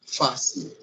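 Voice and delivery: "fácil" with strong FÁ and light -cil; weight on the first syllable of "fácil"; stress on penultimate syllable. Shown as a demonstration. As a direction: demonstrate "fácil" as FAH-seew. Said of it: "a sílaba forte é a penúltima sílaba".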